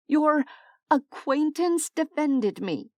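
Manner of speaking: disbelief. Acted. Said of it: "stared at him in disbelief".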